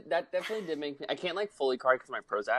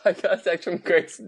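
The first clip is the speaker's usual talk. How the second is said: laughing; 5 semitones higher, 3 dB louder than usual. Real, not acted. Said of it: "laughs while starting story".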